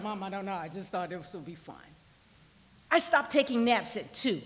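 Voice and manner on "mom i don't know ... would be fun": low, soft, small reply; sheepish child's excuse. Retold or imitated. Child explaining to a cross mother, sheepish. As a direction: imitate a child owning up meekly.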